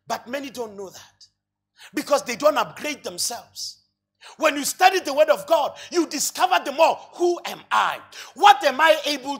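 loud, building sermon delivery over music; preaching at full force. Acted. Preacher rousing the church, full-force preaching. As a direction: preach it out to the congregation.

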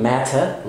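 MATTER as "mat-tah"; British matter, crisp T; British clear T. Shown as a demonstration. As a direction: hit a true T, no R, in matter. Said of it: "في البريتش إنجلش بنقول".

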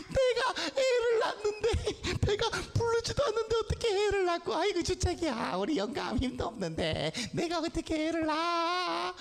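put-on high-pitched old woman, half laughing; hammed-up high granny scoffing at absurd news; incredulous Sarah. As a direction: squeal in amused disbelief as an old wife. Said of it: "사라가 너무 말이 안 돼서".

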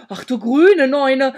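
loud, drawn-out cry of shock; startled exclamation. A narrator's reading. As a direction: call it out aghast, slowly and loudly. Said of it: "rief ich".

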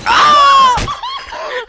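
loud, very high scream; sudden high-pitched yelp of alarm; startled scream. Real, not acted. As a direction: shriek out loud. Startled in the game.

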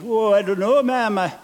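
a hint of a Scottish boy; Scottish lad. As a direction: son answering his mother, lightly Scottish. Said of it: "And he said".

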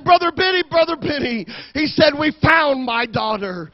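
high opening call, settling as it goes; retold excitement. Father phoning the pastor with news of his daughter, retold excitement. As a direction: blurt the good news down the phone. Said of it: "I have a very excited man".

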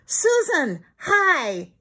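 lightly voiced boy yelling hi; boy yelling hello. A narrator's reading. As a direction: call out to the cousin with joy. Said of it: "yelled Timmy".

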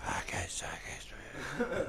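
breathy mocking whisper of an English player. Scottish gamer mocking an English player's whispering, hushed mock whisper. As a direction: whisper it like a creepy weirdo, mocking. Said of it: "start whispering shit".

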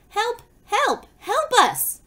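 high, repeated storybook calls; high-pitched mock distress calls; faraway cries for help. A narrator's reading. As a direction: call out high as if from far off. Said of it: "shouted a faraway voice".

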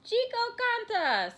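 high, loud, drawn-out full name; scolding call. A narrator's reading. Mother finding Chico on the hat rack, scolding.

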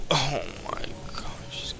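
slow, breathless whisper; despairing whisper; whispered exasperation. Real, not acted. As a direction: say it under your breath in disbelief.

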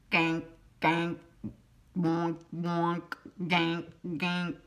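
nasal-free mock quacking; small duck quacks. A narrator's reading. Narrator voicing small ducks begging for food.

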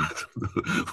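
stifled giggle under the breath; suppressed giggles. Real, not acted. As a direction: hold back a laugh. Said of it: "思わず吹き出しそうになっちゃうんだ".

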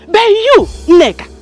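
very high, loud call-out; loud jab. Acted. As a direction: round on her loudly. Debtor turns taunt on creditor.

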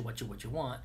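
ordinary delivery

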